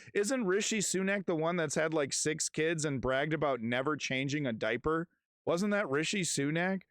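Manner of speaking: ordinary talk, nothing marked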